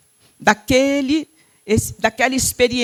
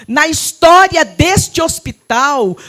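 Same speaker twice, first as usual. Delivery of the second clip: preached doctor quote; 4 semitones higher, 6 dB louder than usual. Retold or imitated. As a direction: belt out the doctor's words to the church. Doctor's words, preached loudly. (citação pregada em voz alta)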